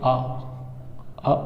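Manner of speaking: आ as a very short 'uh'; short vowel demo. Shown as a demonstration. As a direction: cut आ short, as in 'cut'. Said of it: "इसको बोलेंगे". (आ को छोटा 'अ' बोलें)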